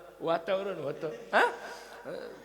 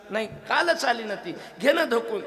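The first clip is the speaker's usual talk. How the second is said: raised, exasperated wife's reply; sharp retort; 5 semitones higher, 6 dB louder than usual. Retold or imitated. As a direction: snap back at the husband, louder. Wife scolds husband. (बायकोचा चिडका, मोठ्या आवाजातला जवाब)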